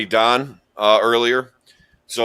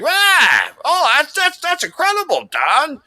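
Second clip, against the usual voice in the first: much higher mock voice, wide-eyed and gushing; Bergman's voice; slower than usual. Friend mimicking Bergman gushing over Don's story, high mock voice. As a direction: squeak it out like an overexcited buddy. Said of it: "I'll do Bergman's voice".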